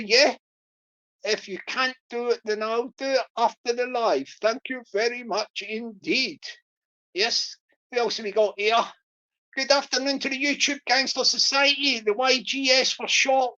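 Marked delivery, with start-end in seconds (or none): none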